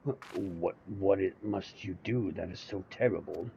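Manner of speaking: a lightly voiced, worried friend; startled companion. The companion asking the noble what's wrong, in alarm. Other NPC voiced lower.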